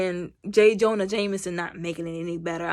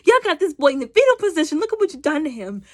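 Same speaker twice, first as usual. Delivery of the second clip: higher, louder and fast indignant outcry; outraged exclaiming; 8 semitones higher, 6 dB louder, faster than usual. Retold or imitated. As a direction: protest loudly for the poor kid. Outraged at the film's cruelty.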